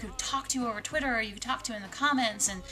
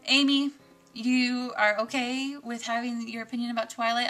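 ordinary talk, nothing marked

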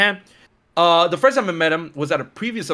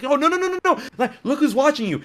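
excited reassurance; 10 semitones higher than usual. Retold or imitated. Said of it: "she's like".

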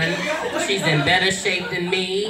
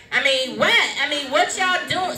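local DC voice; 8 semitones higher than usual. Shown as a demonstration. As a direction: throw on the Alexandria street talk. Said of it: "I started talking like".

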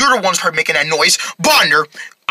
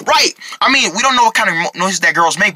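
ordinary talk

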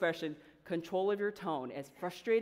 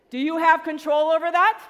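loud, high rally question with a rising end; calling out to the crowd; 9 semitones higher, 13 dB louder than usual. Real, not acted. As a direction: demand an answer from the whole room. Prompting the audience to answer.

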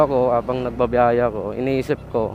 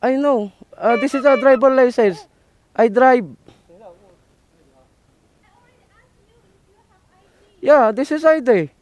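urgent pleading; 11 semitones higher, 5 dB louder than usual. Real, not acted. Pleading with officer over ID.

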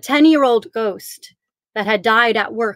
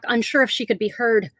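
ordinary delivery

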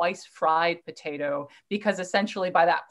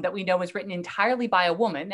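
ordinary talk

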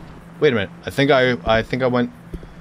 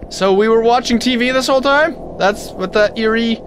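high, louder amazed realization; surprised realization; 6 semitones higher, 3 dB louder than usual. Real, not acted. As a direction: realize it with disbelief. Discovers the TV source.

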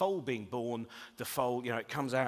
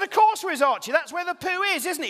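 exasperated dad; 11 dB louder than usual. Retold or imitated. Patient dad answering son.

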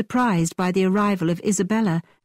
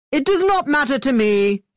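a hint of a boastful gentleman, eager; lightly voiced brash young man; 6 semitones higher, 3 dB louder than usual. A narrator's reading. Thorpe hurrying Catherine into the carriage, cocky. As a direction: play Thorpe as cocky and pushy.

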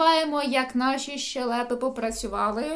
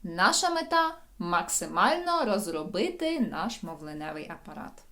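normal talking voice

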